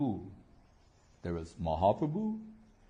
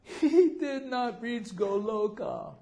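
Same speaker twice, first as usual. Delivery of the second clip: high, slow mock crying; high mock lament at the funeral; mock weepy wail; 11 semitones higher, slower than usual. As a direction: wail it out in a hammed-up way. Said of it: "So she's saying".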